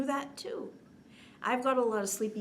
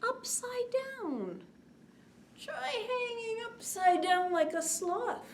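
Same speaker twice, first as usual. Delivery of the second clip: playful; 11 semitones higher than usual. Acted. Playful sloth pose cue.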